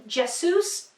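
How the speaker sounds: Jesus said JESS-oos; short e, oo ending in Jesus; awkward pronunciation. Shown as a demonstration. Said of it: "just doesn't sound right".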